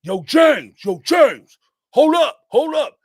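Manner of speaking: loud mock call across the yard; retold hollering. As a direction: yell the name to stop him. Two dudes hollering his name.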